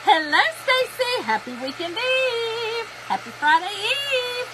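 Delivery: high, slow, drawn-out hello to a viewer; sing-song greeting. Real, not acted. Greeting a viewer who just joined, high and sing-song. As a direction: welcome Stacy in a lilting high voice.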